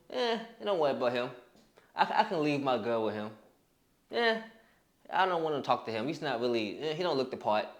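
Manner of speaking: low, quiet dismissive aside; unimpressed onlooker, low and offhand. Acted. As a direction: brush him off under your breath. Others dismissing him in thought.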